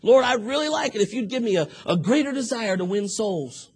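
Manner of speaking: mean every word; sincere prayer. Retold or imitated. Man praying in earnest to the Lord, sincere and pleading. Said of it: "sound like you meant that".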